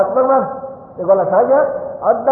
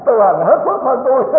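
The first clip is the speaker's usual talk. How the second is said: higher, words squeezed out through laughter; laughing while talking; 5 semitones higher than usual. Real, not acted. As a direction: speak while still laughing. Laughs at his own joke. (vừa nói vừa cười, giọng cao hơn)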